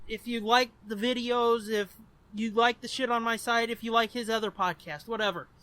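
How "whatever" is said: normal talking voice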